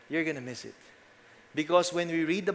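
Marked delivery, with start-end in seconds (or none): none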